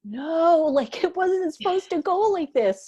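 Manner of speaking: high, loud wailing no; distraught protest. Retold or imitated. Friend retelling a sad movie ending, high wailing protest. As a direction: cry out against the ending, voice way up. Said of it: "and I'm like".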